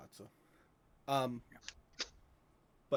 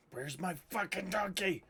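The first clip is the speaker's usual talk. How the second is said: high-pitched hammed-up holler; mock demanding yell; 8 semitones higher, 4 dB louder than usual. Game master echoing Cal outside the gates, mock yell. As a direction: call it out as a playful imitation of someone fuming. Mimicking Cal calling for his donkey.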